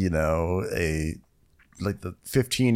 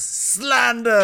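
mock outrage; 12 semitones higher than usual.

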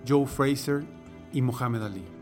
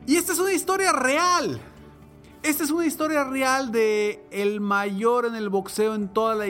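loud, high, rousing podcast host; booming motivational speech; 7 semitones higher, 3 dB louder than usual. Acted. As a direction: pump up the listener with big energy. Podcast intro, raised energy.

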